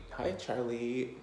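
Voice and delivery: high, slow coo to a pet; pet talk. Real, not acted. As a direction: sweet-talk the dog. Greeting the pet Charlie.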